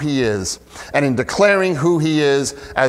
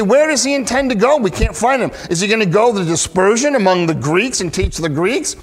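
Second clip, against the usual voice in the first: higher, quick imitated puzzlement; perplexed questioning; 7 semitones higher, faster than usual. Crowds who can't wrap their minds around it, imitated perplexity. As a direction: rattle off the crowd's questions in a high, baffled voice.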